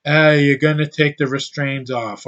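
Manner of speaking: a lightly put-on British accent; mock British accent. Mocking British actors, lightly put-on British accent. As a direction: toss it off like a bored Brit. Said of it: "some Brit ... that sounds".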